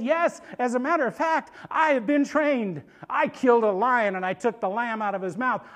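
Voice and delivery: loud, high, emphatic testimony; fired-up boast. Acted. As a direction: proudly insist on your readiness. David boasting of his victories.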